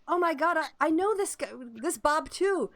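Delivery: a touch of disbelief, voice lifted high; shocked bystander. Acted. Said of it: "someone else comes over and looks and like".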